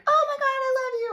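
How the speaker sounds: swooning fake crush gush; mock gushing. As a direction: ham up a giddy lover's squeal. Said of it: "They're just not like that".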